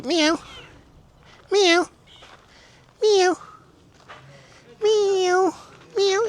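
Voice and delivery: high-pitched mimicked meows; cat meow imitation. Imitating cat among the kittens.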